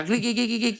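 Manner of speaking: high, rhythmic horse neigh; imitated horse whinny. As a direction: neigh in quick rhythmic bursts. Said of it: "Kuda itu masih ada iramanya". (tiruan ringkikan kuda berirama)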